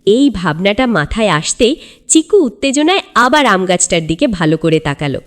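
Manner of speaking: plain reading voice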